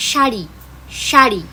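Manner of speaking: teacher demonstrates the palatal s. Shown as a demonstration. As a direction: stretch the sh in শাড়ি. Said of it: "সবকটা এই ওয়ার্ডগুলো".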